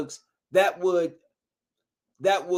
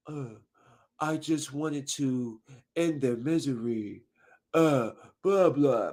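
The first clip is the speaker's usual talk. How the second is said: slow, low, soft killer imitation; mock somber slowness; 4 semitones lower, 5 dB quieter, slower than usual. Imitating the killer nurse explaining himself, mock stoic and slow. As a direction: drone it out as if deep in thought. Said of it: "that's how he was talking".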